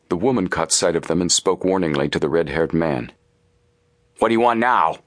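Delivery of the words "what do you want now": demanding. A narrator's reading. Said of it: "he demanded".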